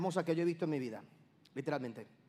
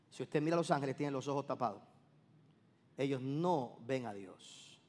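normal talking voice

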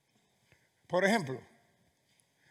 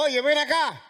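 loud, high summoning shout; parent calling children; 9 semitones higher, 9 dB louder than usual. Acted. Parent orders the children to come here, loud commanding call. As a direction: call a child over with real force. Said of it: "A tus hijos".

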